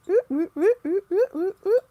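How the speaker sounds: high, loud oop oop oops; mock slipping sounds. Real, not acted. Showing Brie how slippery energy feels, high oops. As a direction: yelp oop over and over as if slipping.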